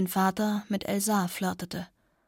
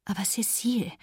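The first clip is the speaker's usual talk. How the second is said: whispered plea. Acted. Elsa pleads in quoted speech.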